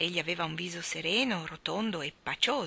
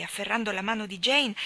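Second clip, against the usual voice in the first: usual speaking voice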